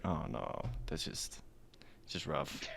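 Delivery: soft whisper. Retold or imitated. Friend recalling when Lance ran over his dog, whispered. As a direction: breathe it out under your voice.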